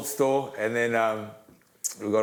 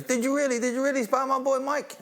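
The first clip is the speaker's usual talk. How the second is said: high-pitched incredulous questioning; mock astonishment, pitched way up; American bar stranger; 13 semitones higher than usual. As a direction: imitate a stranger surprised by the claim.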